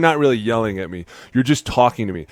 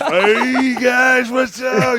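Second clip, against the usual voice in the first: imitating Freddie Kitchens; 13 semitones higher, much slower than usual. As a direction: call out like a cheesy eighties coach. Imitating Freddie greeting players.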